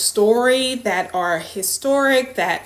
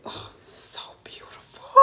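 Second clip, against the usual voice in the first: near-tearful whisper; choked-up whispered awe; moved whisper; 17 dB quieter than usual. Real, not acted. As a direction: whisper it, almost crying. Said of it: "I'm not gonna cry, but I lightweight want to".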